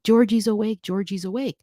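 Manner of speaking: mock yell, starting loud then easing off; retold excitement. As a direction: replay mom's excited news. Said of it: "she's excited".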